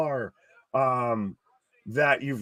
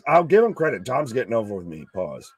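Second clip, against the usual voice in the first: normal talking voice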